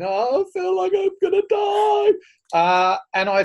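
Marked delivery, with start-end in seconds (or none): 0.0-2.1 much higher, hammed-up anguished cry; mock dying wail. Filming himself on the cliff, in a mock dying wail. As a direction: ham up a wail like you're dying on the trail. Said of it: "so I'd still be going".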